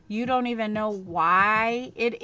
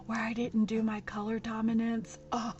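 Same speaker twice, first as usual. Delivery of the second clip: mock horror; 5 dB quieter than usual. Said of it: "I might tell everybody myself".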